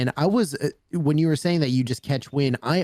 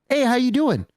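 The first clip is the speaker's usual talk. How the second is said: higher, louder, bubbly hello from a stranger; super excited greeting; 13 semitones higher, 4 dB louder than usual. Retold or imitated. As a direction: greet like an old friend, over the top. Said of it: "like, super, like, excited".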